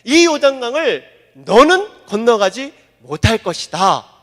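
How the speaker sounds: loud, slow, heavy decree; God's solemn verdict. Acted. Preacher voicing God forbidding Moses to cross, solemn and loud. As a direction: hand down the judgment slowly. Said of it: "하나님이 이렇게 얘기하는 거예요".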